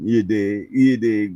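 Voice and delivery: usual speaking voice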